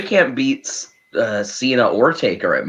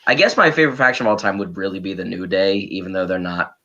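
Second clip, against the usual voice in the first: low, fast mumbling impression of Mark; channeling Mark; 6 semitones lower than usual. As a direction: drop the pitch and rattle it off as Mark. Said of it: "really channeling my inner Mark here tonight".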